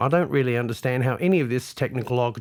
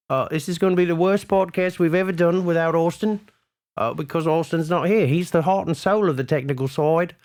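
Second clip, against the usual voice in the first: ordinary talk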